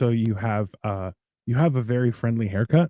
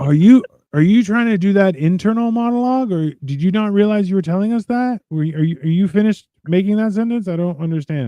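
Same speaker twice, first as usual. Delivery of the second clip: higher, insistent mock questioning; exasperated bafflement; 7 semitones higher, 4 dB louder than usual. Man challenging somebody who talks nonsense, exasperated bafflement. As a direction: press them in baffled disbelief. Acted challenge to a rambling speaker.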